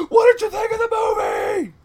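high-pitched, loud yell. Acted. Pitching a film to an executive, yelling. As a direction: shout the question at top pitch. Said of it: "Why are you yelling?".

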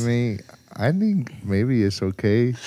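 slow, timid put-on voice; timid inner voice. Timidly replying to voice in head, slow hesitant voice. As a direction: answer meekly, drawing it out. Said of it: "your voice is timid in your head".

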